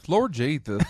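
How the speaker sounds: Southern preacher voice. Retold or imitated. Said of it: "West Virginia pastor".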